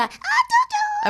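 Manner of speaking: squeaky high pet talk; dog voice. Retold or imitated. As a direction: coo at a dog, very high and slow. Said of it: "the little squeak in my ear".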